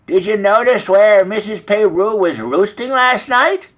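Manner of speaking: high storybook hen voice; gossipy hen. A narrator's reading.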